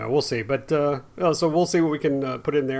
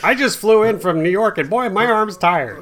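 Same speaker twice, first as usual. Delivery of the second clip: higher, louder, hammy stand-up delivery; old borscht belt comic; 7 semitones higher, 6 dB louder than usual. Acted. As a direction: land the old vaudeville gag. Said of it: "Darn it, I was gonna use that joke".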